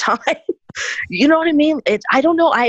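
ordinary talk

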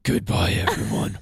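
loud gravelly Batman voice. Acted. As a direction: growl it like Batman. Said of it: "Do you wanna hear my Batman voice?".

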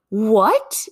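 loud, high reenacted cry of disbelief; shocked disbelief. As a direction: blurt it out high, acting stunned. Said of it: "I was in disbelief. I was shocked".

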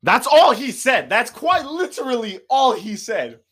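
can't believe it, loud and high; exasperated disbelief. Real, not acted. Telling a friend about a rude customer, exasperated and loud.

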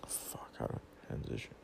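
muttered whisper to himself; whispered aside. Real, not acted. A vlogger whispering to himself about transitions, a real hushed aside. Wondering aloud how to transition.

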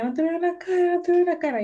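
imitating a crying child. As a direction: mimic a toddler sobbing as if the world were ending. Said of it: "se puso a llorar mu-, pero como si se estuviera muriendo".

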